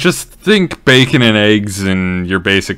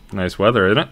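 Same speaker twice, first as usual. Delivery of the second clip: mock small talk; 3 dB quieter, faster than usual. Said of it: "being like".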